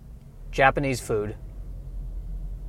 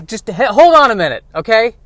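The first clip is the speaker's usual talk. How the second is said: flustered; 9 semitones higher, 10 dB louder than usual. Acted. Host flustered, stopping guest.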